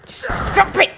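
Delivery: high, annoyed cry; sudden high-pitched protest; annoyed outburst. Real, not acted. Player being shot by teammates.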